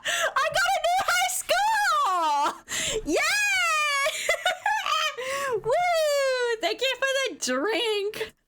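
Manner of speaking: very high, laughing squeal of triumph; elated. Real, not acted. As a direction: celebrate wildly, shrieking and stretching words. Celebrating a new high score.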